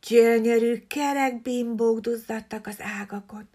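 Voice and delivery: ordinary delivery, nothing marked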